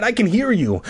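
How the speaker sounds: high acted protest; crying out. A narrator's reading. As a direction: protest up high without really shouting. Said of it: "I cried".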